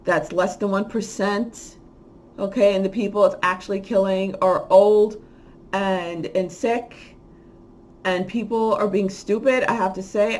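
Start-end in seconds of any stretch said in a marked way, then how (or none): none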